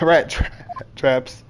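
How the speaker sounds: usual speaking voice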